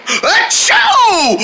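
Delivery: sneeze. Acted. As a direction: let a sneeze explode. Character sneezing.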